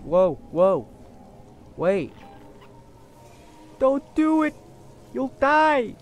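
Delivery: loud panicked warning, high and urgent; alarmed shouting. Real, not acted. Player shouting a warning at his teammate, loud and alarmed. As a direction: yell at him to stop before he dies.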